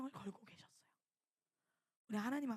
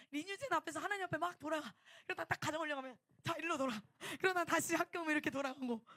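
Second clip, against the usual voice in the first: loud and higher, rushing through each turn; excited preaching; 4 semitones higher, 6 dB louder than usual. Real, not acted. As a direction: build it up like a lively sermon. Preacher building excitement about turning. (신나게 높아지는 설교 목소리)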